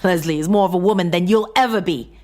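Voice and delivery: loud, fast spat insult. A narrator's reading. John to his wife, fast spat insult. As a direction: spit it at her. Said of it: "John spat".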